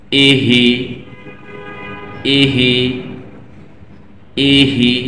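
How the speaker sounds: ইহি said as one joined word; joined syllables. Shown as a demonstration. Teacher showing the learners the joined sound. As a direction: blend ই and হি into ইহি smoothly. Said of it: "এবার এই দুই হরফের উচ্চারণ একত্রে".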